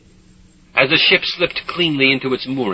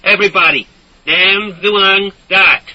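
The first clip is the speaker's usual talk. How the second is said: loud level voice; 8 semitones higher, 7 dB louder than usual. A narrator's reading. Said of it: "in a loud, level voice".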